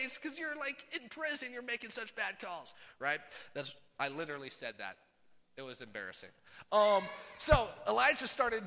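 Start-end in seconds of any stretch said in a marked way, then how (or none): 0.0-2.7 high-pitched complaint easing off from a yell; retold indignant heckling. Man watching basketball griping at refs, mock indignant.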